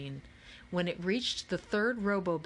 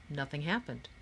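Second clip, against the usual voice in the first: plain reading voice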